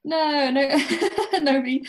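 real laughter breaking through a quick denial; laughing. Real, not acted. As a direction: laugh out the no's, amused. Host jokes about selling drugs.

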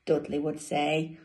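plain reading voice

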